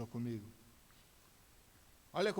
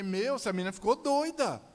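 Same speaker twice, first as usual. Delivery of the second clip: loud, high-pitched exasperation; outraged exclamation; 7 semitones higher, 9 dB louder than usual. Retold or imitated. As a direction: burst out in indignant disbelief. Father outraged, tells wife.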